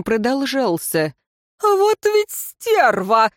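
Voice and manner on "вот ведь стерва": high, slow enraged shout; furious yell. A narrator's reading. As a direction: roar it in fury. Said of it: "заорал Тайрат". (яростный вопль)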